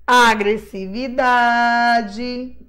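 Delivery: sílaba por sílaba, bem lento; bem devagar. Shown as a demonstration. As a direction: estica "Agressividade" devagar, sílaba a sílaba. Repete palavra bem devagar. (falar "Agressividade" bem devagar)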